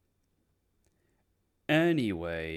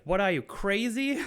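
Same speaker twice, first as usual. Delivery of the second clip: high, louder incredulous Penguin; 12 semitones higher, 5 dB louder than usual. Retold or imitated. Penguin scoffs at the detectives, high and loud. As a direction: scoff it like a cornered mobster. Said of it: "He's like".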